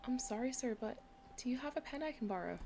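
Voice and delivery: a hint of an Irish lilt; mild Irish accent asking for a pen; light Irish accent. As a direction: play it lightly Irish, polite. Voices player character in Irish accent.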